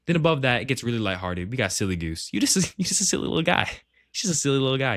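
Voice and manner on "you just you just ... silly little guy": high, fast, giggling through the words; laughing. Real, not acted. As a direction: laugh it out, teasing a silly friend. Teasing silly goose tier.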